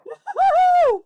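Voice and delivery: very high loud cackle; shrieking laugh. Real, not acted. Streamer losing it over the game, high shrieking laugh. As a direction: burst into a screeching laugh.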